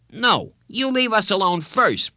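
high, heated schoolboy retort; indignant kid's comeback; quarrelling boy. A narrator's reading. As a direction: fire it back, pitched well up. Boy quarrelling before a shove.

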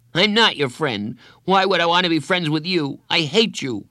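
put-on high, loud child's retort; angry rejection. A narrator's reading. Boy rejects counselor's friendship, angry.

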